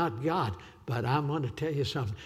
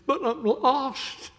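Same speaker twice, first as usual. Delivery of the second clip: high, slow, rising loud cry; old lady's lament; 9 semitones higher, 5 dB louder than usual. Retold or imitated. Grandma confesses to her pastor, crying out. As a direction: cry it out like a lost old woman. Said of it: "she looked up and said".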